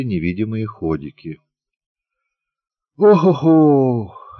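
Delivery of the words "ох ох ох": long, slow, higher, louder yawning sigh; a woman yawning sweetly before bed. A narrator's reading. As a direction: stretch it out as a drowsy yawn. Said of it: "она сладко зевнула".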